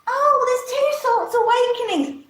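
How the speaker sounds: mock smug quote. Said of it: "They're sitting there going".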